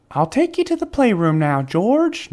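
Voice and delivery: lightly voiced nurse. A narrator's reading.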